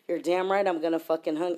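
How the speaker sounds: normal talking voice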